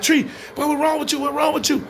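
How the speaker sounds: high-pitched panicky concern; alarmed friend. Retold or imitated. Acts friend alarmed as he collapses.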